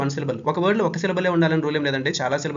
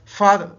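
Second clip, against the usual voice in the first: Father with ఫా hit louder; stress on first syllable; 3 semitones higher, 6 dB louder, much slower than usual. Shown as a demonstration. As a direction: push the ఫా of father out loud. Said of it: "ఎఫ్ మీద stress అండి".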